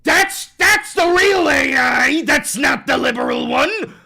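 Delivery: high loud mock right-winger; mocking shout. Streamer mocking right-wingers celebrating their own AI, shouting. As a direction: ham up a gloating fanatic. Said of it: "they're gonna go".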